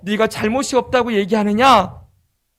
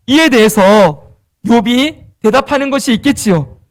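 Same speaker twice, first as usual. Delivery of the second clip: ordinary delivery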